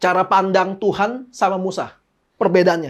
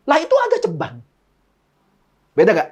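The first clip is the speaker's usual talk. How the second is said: normal talking voice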